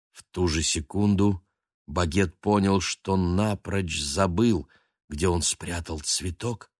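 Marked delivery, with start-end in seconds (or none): none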